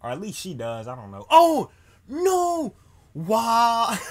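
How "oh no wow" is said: high, loud yelps of real shock; shocked outcry. Real, not acted. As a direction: blurt it out, caught totally off guard. Sudden shock at game event.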